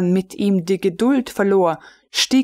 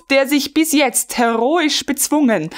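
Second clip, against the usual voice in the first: usual speaking voice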